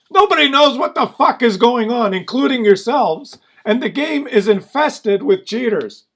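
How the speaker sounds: loud, high-pitched angry outburst; fed-up shouting at the developers; exasperated rant. Real, not acted. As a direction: raise your voice in real exasperation.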